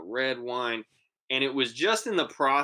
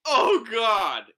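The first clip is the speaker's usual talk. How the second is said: loud, high exclamation of dismay; alarmed outburst; 8 semitones higher, 7 dB louder than usual. Retold or imitated. Boyfriend learns who drank the drink, loud mock alarm. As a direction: imitate sudden horror, louder and higher.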